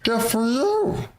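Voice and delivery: high longing character voice; longing NPC voice. Acted. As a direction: plead with longing eyes. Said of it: "looks at you with longing eyes".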